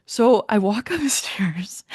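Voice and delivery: slow whisper with a giggle, building suspense; hushed storytelling. Real, not acted. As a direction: whisper it slowly as if creeping upstairs.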